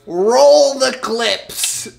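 slow, loud, drawn-out announcer call. Pretend. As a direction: boom it like a showman.

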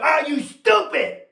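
high, slowed challenge punching "stupid"; indignant preacher's cry landing hard on "stupid"; shouted rebuke. Real, not acted. Challenges congregation, then softens to we.

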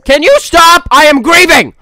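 high, loud indignant yell; mock angry shout. Streamer yelling at the game to let her grieve, mock outrage. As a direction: shout down the interruption.

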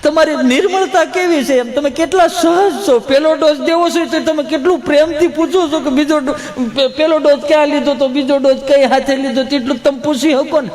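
high, hammed-up sobbing praise; mock weeping. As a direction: gush to the nurse as if about to cry. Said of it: "એમાં રડવાનું શું?". (રડતાં રડતાં વખાણ, નાટકીય)

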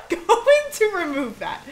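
high laugh into slow words. Real, not acted. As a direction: laugh through the line. Laughing at something.

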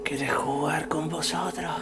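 creepy soft whisper; haunted whisper; possessed whisper. Acted. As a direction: whisper it like something inside him is speaking. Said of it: "Está poseído".

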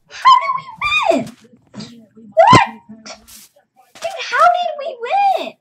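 very high, loud disbelief; astonished shouting. Real, not acted. Player stunned by surprise win, astonished shouting. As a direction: squeal it out high and loud, incredulous.

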